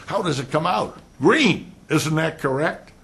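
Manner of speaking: high, loud badgering lawyer; mock lawyer questioning. Shown as a demonstration. Lecturer mocking a courtroom lawyer, badgering. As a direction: needle the witness like a cross-examiner.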